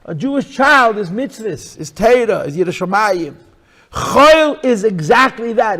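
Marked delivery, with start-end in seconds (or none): 3.9-5.8 loud, slow emphatic declaration; preaching loud. Real, not acted. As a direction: proclaim it slowly. Preaching at full force.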